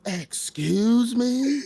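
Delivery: very slow, high-pitched incredulous question; hammed-up shock; mock disbelief. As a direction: stretch it out like a stunned audience. Theater audience's shock, acted out.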